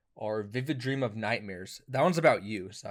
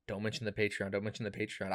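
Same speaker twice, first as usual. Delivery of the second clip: softer, lower self-reminder; hushed inner aside; 3 semitones lower, 7 dB quieter than usual. Acted. Man to himself as friend pleads poverty, hushed aside. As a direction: say it under your breath to yourself.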